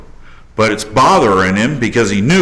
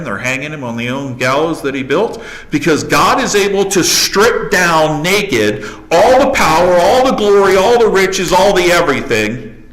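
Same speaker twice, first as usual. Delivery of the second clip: building sermon climax; 8 semitones higher than usual. Acted. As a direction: drive each "all the" harder.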